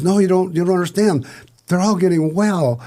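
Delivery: imitating an excited child, high-pitched; childlike excitement. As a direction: gush it like an amazed little kid. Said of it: "like a little child".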